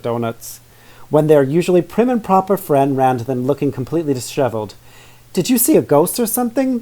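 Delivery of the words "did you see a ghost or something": high voiced girl exclaiming; girl's exclamation. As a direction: exclaim it as the young sister. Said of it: "Vivian exclaimed".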